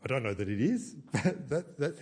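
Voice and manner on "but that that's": a small self-aware laugh. Real, not acted. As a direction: chuckle through the words. Laughs at himself.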